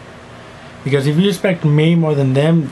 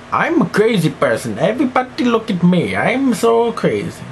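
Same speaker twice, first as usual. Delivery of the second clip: mock crazy person; 7 semitones higher than usual.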